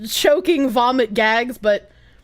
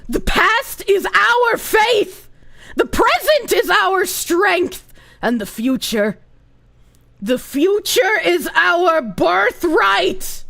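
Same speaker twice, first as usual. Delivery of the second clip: slow, grand ad voiceover; inspirational read; 4 semitones higher, slower than usual. Pretend. Streamer reading a quote like a Hello Fresh inspirational ad. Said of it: "read it, like, inspirationally".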